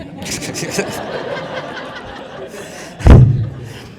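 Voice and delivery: high ringing laugh; big laugh. Real, not acted. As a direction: burst out laughing. Father laughs at Andy.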